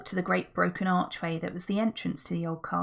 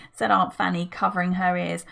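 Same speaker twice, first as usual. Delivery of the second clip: usual speaking voice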